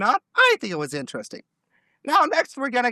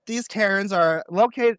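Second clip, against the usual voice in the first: normal talking voice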